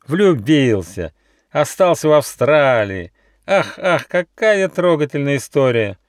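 sarcastic fox. A narrator's reading. Fox mocks the love story.

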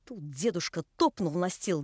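usual speaking voice

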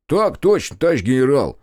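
high, loud parade-ground reply; mock salute. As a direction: snap to attention as a joke. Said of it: "козырнул Заварзин". (шутливо по-военному, громко и высоко)

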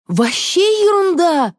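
high, drawn-out indignant squeal. A narrator's reading. Man in jeans dismissing it all as nonsense, squealing. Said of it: "взвизгнул тип в джинсах".

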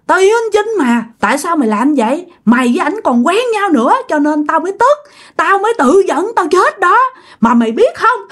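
high-pitched, aggrieved rant; acted vengeful spirit's complaint; bitter accusation. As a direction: blame your friend for your death. Spirit accuses friend of betrayal.

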